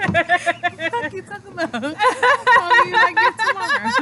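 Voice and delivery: high, slow mock scolding. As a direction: imitate the friend telling her off, drawn out. Mocking friend's scolding reply.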